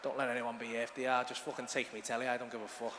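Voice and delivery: low, hushed pleading to himself; acted silent prayer under the breath; fearful inner thought. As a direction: mumble it very low and soft. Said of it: "I was thinking".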